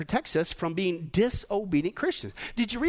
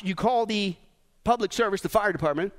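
normal talking voice

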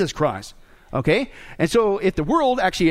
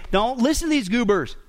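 emphatic disbelief; 6 semitones higher than usual. Retold or imitated. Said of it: "He's very emphatic about it".

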